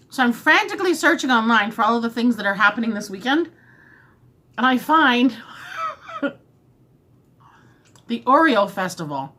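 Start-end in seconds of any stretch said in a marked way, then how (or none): 5.4-6.3 squeaky little laugh; high giggle. Real, not acted. Vlogger laughing at her own plan, squeaky giggle. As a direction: giggle up high at the silly answer.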